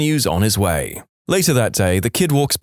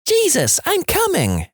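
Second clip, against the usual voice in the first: very high squealed cry; child imitating a scream, much higher; mock scream. Boy retells what mommy screamed, very high mock scream.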